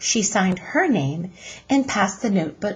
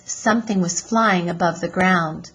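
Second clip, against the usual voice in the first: plain reading voice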